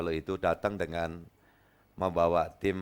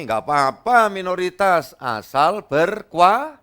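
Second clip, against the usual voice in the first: loud, much higher rallying reply; emphatic retort; 10 semitones higher, 10 dB louder, slower than usual. Retold or imitated. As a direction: fire it back like a battle cry. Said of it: "ngomongnya gini".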